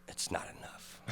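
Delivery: breathy hushed whisper. Retold or imitated. Drunk fan telling the rapper it's not enough, whispered. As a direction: drop to a secret whisper.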